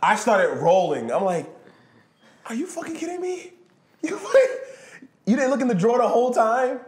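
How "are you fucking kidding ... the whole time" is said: high, loud incredulous laughing cry; laughing disbelief. Retold or imitated. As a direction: crack up in disbelief.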